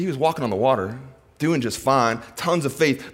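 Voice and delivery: ordinary talk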